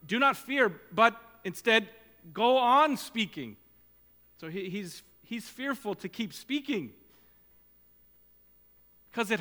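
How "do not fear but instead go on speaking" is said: forceful command. Acted. God's command to Paul quoted.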